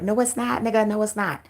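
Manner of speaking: heated no it's not. Real, not acted. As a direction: snap back at him, fed up. Heated rant at him.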